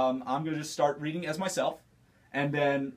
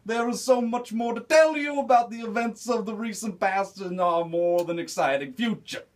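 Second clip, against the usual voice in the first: Bill Cosby impression; 6 semitones higher than usual. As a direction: stretch it out like a playful old comic. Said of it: "Bill Cosby".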